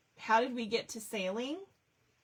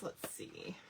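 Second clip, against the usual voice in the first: whispered aside; 2 semitones lower, 13 dB quieter than usual. Real, not acted. Muttering to herself while searching.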